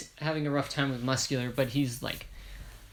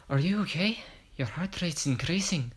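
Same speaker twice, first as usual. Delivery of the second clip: attempted Irish lilt, pitched higher; Irish accent; 4 semitones higher than usual. Phoenix asks if he's okay, attempted Irish accent. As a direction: lightly try an Irish accent on the robot's line. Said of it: "Irish slash Scottish".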